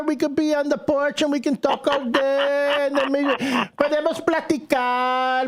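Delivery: high, singsong mock mother voice; hammed-up high-pitched motherly plea; imitating mom. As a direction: imitate mom coaxing, stretching out the last phrases. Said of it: "My mom is like".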